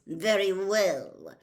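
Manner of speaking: slow, menacing teacher voice; a cold potions master toying with the class; Snape's cold drawl. Acted. Potions master addressing his class, slow and menacing.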